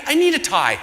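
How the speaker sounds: high, loud comic panic; frantic. Retold or imitated. Telling the crowd of his missing tie, loudly frantic. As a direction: push it up high and urgent. Frantic realisation before arriving.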